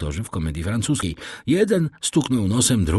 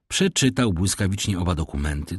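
plain reading voice

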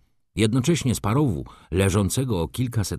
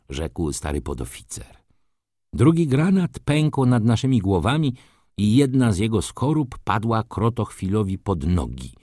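plain reading voice

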